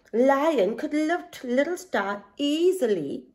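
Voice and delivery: ordinary delivery, nothing marked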